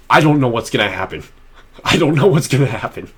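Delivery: ordinary delivery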